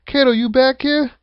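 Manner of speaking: imitating a wrestler calling for his partner. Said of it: "you hear Joe go".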